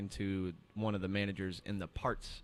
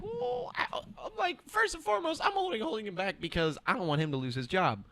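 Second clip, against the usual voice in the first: much higher, rising to louder threat; indignant retort; 12 semitones higher, 9 dB louder than usual. Retold or imitated. Telling the customer off, indignant and building louder. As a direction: put him in his place. Retelling her reply to customer.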